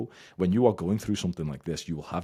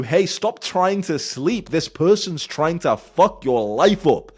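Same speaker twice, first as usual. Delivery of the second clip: slow, very loud, high warning; urgent shout; 13 semitones higher, 14 dB louder, slower than usual. Acted. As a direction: bellow it like an alarm. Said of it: "your body is literally telling you".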